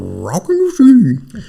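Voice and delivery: mock Scooby-Doo dog talk. Co-host jokes about a talking dog, Scooby voice. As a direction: slur it like a cartoon dog. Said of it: "Scooby voice".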